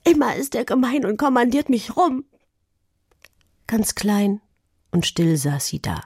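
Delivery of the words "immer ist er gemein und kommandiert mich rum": high-pitched, aggrieved whine; whining. A narrator's reading. Pia complains about her bossy brother, whining. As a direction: complain like a hard-done-by child. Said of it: "jammerte sie".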